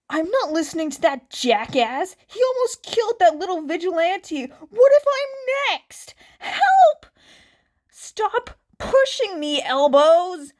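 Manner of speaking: high, loud panicked shouting from the crowd; panicked crowd yelling. Acted. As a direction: yell like a terrified spectator shoving to escape.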